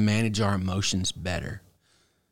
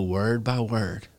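drawn-out, deliberate pacing; slowly, one word at a time; slower than usual. Shown as a demonstration. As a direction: stretch out each word to show slow reading. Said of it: "Read it slow".

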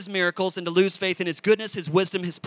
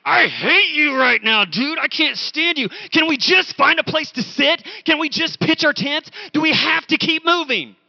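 higher, louder, whining frustration; exasperated inner rant; 7 semitones higher, 5 dB louder than usual. Retold or imitated. Preacher replays his exhausted inner rant at his friend, whiny and exasperated. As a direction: vent like an exhausted hiker. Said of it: "I was like".

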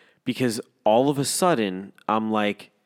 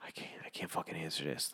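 hushed, voiceless dread; seeing an old coworker calling, whispered dread; panicked whisper; 4 semitones lower, 13 dB quieter than usual. Retold or imitated. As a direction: whisper it as if the caller could hear. Dreading a phone call.

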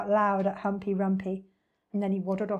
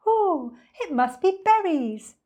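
high, lilting delight; singsong bird; 9 semitones higher, 5 dB louder, slower than usual. A narrator's reading. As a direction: sing it out like an excited bird. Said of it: "sang the roly-poly bird".

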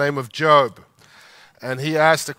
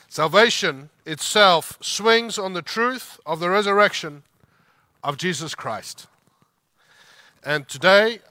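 normal talking voice

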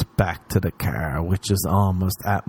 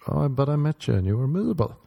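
fast retort; 6 semitones higher, 3 dB quieter, faster than usual. Retold or imitated. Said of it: "And you're like".

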